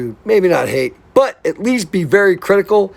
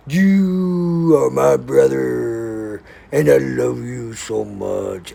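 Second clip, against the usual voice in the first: slow tender; 3 semitones lower, much slower than usual. Real, not acted. Heartfelt message to friend Joe.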